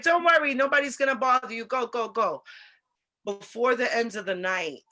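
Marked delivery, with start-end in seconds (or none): 0.0-2.4 much higher and louder, rushing through it; excited reassuring call. Retold or imitated. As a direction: call it out to wave him in. She reassures the celebrity excitedly.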